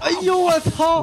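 high, slow shocked cry. Real, not acted. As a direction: recoil in disgust, slow and high. Shocked reaction to joke.

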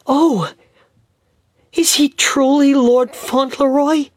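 a mother who can hardly believe it; lightly voiced mother, breathless with wonder; astonished mother. A narrator's reading.

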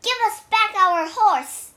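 high, loud order; demanding. A narrator's reading. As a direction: demand it back with a shout. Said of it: "demanded Wukong".

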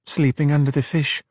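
plain reading voice

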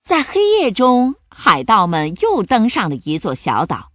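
usual speaking voice

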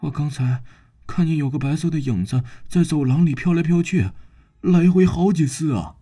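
quaking with fear as he tells it; shivering. Acted. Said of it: "疑犯哆哆嗦嗦地说".

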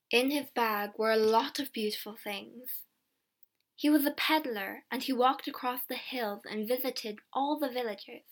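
plain reading voice

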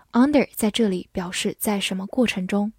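normal talking voice

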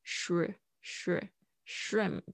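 "shri-, shri-, shrimp" very slow, syllable by syllable; slow broken-down shrimp. Shown as a demonstration. Teacher slowly drilling "shrimp" for learners. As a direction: break "shrimp" apart and build it up slowly. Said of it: "这个R I".

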